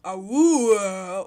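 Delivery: loud howl-like awoo cry. Acted. Game noise read aloud.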